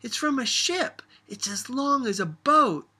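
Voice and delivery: put-on high, loud excited child's holler; loud excited girl's holler. A narrator's reading. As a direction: shout it out like a child with a find. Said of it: "she hollered".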